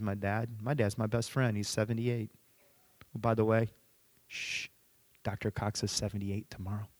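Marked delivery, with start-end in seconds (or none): 3.1-6.9 hushed, conspiratorial, much softer; mock-secret aside. Pastor confides Doctor Cox's birthday to the congregation, hushed mock secret. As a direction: drop your voice as if letting out a secret.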